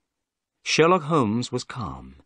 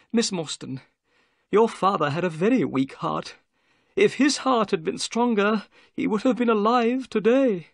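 high-pitched odd little host; nervous little man; 9 semitones higher than usual. A narrator's reading. Little man tells woman of her dead father, tactless. Figure of Thaddeus Sholto.

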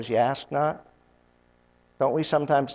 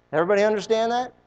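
ordinary delivery

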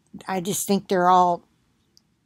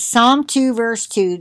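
usual speaking voice

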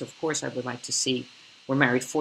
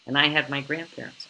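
normal talking voice

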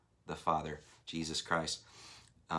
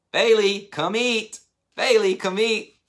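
high, loud sing-out call; calling the dog; 12 semitones higher, 9 dB louder, slower than usual. Retold or imitated. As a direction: imitate a recorded call to a dog at mealtime. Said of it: "his voice saying".

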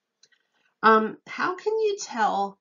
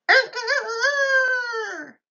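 high, loud rooster crowing call; 5 dB louder, much slower than usual. Retold or imitated. Teacher crows like a rooster for children. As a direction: crow like a rooster waking the farm. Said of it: "wakes up the farmer with its".

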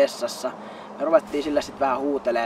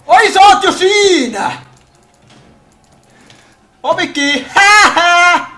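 mocking; 13 semitones higher, 13 dB louder, slower than usual. Real, not acted. Taunting player already there.

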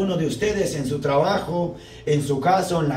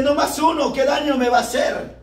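drinker's excuse; 8 semitones higher than usual. Retold or imitated.

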